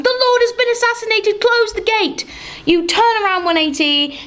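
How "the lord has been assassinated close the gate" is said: guards shouting. Acted. Guards in the market square call out the assassination, high-pitched. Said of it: "Guards fill the market square shouting".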